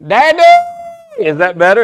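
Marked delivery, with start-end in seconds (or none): 0.1-0.4 high, loud call; high-pitched holler; loud and proud yell. Shown as a demonstration. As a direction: belt it out proudly. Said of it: "You gotta be loud and proud".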